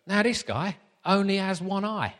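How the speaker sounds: comic Essex accent impression. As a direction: retell it in a broad Essex accent. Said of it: "So I'll do the accent again".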